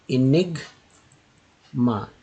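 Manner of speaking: Enigma drawn out slowly, each syllable spelled out. Shown as a demonstration. Teacher stretching Enigma for students, very slow. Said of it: "देखिए".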